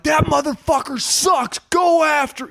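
high, shouted trash talk; mock trash-talk shout. Coach shouting trash talk about the other team's player. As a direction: yell it like a fired-up coach on the headset. Coach shit-talking opponent on headset.

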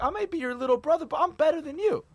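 high, rising mock rant; guy in the restroom ranting about his brother; imitated angry guy. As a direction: play the irritated stranger in the bathroom. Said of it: "He's like".

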